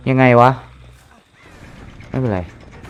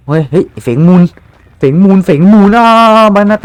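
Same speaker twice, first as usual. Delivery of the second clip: calling out; 6 semitones higher, 7 dB louder than usual. Real, not acted. Calling out on teammate.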